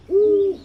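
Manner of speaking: high mock owl hoot; owl hoot imitation. Man copying an owl at the grave, mock hoot.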